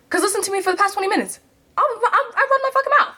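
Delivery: very high, squeaky outburst; exasperated self-mockery. Real, not acted. Calling out own lie about being shy, squeaky exasperated protest. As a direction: rush into a high-pitched comic protest.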